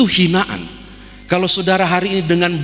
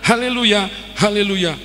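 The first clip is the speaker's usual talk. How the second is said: high-pitched call to a horse; acted yell; 7 semitones higher than usual. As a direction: cry out the command, urging the horse faster. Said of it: "dia teriak tiga kali".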